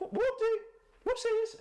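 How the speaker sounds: very high, loud falsetto exclamation; playful squeal. Real, not acted. Streamer reacting to the game, playful high squeal.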